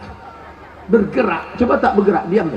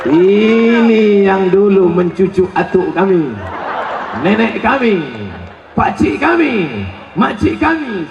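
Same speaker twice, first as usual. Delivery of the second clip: vengeful worm; slower than usual. Pretend. Worms speaking to the dead. (cacing membalas dendam)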